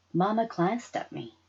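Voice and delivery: plain reading voice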